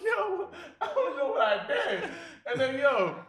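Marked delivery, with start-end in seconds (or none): 0.0-2.1 high, slow laughing whine; crying and laughing. Retold or imitated. Kid hit by dad, laughing and crying. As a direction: plead innocence through laughter. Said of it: "crying and laughing at the same".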